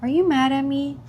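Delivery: put-on high, softened child voice; imitated worried daughter. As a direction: ask timidly as a little child. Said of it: "She's like".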